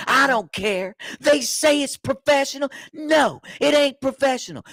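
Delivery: high, slow put-on inmate ranting; yelling inmate. Inmate on the top tier shouting about breaks, put-on ranting voice. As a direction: belt it out like a riled-up inmate. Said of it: "he's just yelling".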